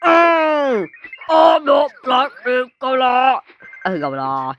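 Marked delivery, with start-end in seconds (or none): 0.0-3.4 high, loud, slow cartoon squeak; indignant squeaky cartoon voice; squeaky character voice. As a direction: protest in a tiny silly creature voice. Kid acting squeaky character voice.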